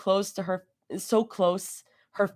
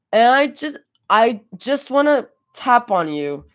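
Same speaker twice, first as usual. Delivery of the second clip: put-on drunk voice; 5 semitones higher, 6 dB louder than usual. A narrator's reading. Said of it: "dead serious".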